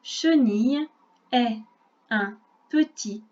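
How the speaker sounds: a slow model reading for a beginner; slow reading aloud. Shown as a demonstration. Teacher reading the text to a pupil first, slowly, word by word. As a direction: read word by word, pausing between words. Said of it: "Je vais lire d'abord lentement".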